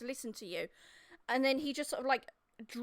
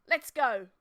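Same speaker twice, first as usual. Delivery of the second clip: louder, curt order; firm command; 8 dB louder than usual. Acted. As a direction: call the march to start. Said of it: "he looks at Gil and he says".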